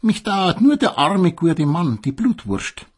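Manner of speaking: high, loud mock-pitying gossip; gossipy sausage. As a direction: gossip like a catty old neighbour. Selchwurst klatscht über das Paar. (hohe, tratschende Klatschbasenstimme)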